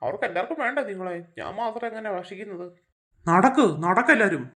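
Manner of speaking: low, gloating monkey monster herding its catch; chimpanzee creature voice. Acted. Chimpanzee monster leads them off. (താഴ്ന്ന ശബ്ദത്തിൽ കുരങ്ങൻ ഭീകരജീവി)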